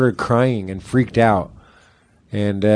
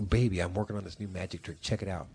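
much softer, partly whispered; hushed aside; 5 semitones lower, 15 dB quieter than usual. Retold or imitated. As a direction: keep it low so the table won't hear. Guy whispering fake magic trick.

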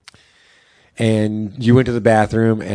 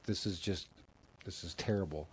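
quiet dismay; 5 semitones lower, 21 dB quieter than usual. Retold or imitated. Girlfriend upset at fake memorial.

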